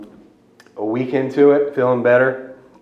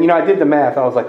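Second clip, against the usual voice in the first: ordinary delivery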